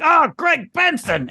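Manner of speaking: high, slow, drawn-out curse; mock curse. Stubbing a toe and cursing the name, high and slow. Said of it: "I would be like".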